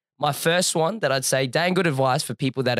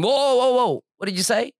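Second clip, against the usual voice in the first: high, louder cut-in of 'whoa'; mock astonishment; 11 semitones higher, 3 dB louder than usual. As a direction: imitate a stunned server stopping the customer. Retold server stops him, astonished.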